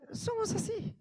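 a giggle at ourselves; laughing squeak. Real, not acted. Laughing at human nature. (riéndose, en falsete)

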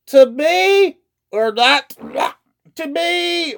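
high, slow, sing-song mock reading; bored teen reading Shakespeare. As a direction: drone it like a bored student in class. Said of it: "best representation of a teenage student reading Macbeth".

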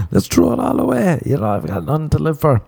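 very high, comic throwing in the towel; mock reckless surrender. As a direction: give up gleefully in a silly high voice. Replays caving in, alone in car.

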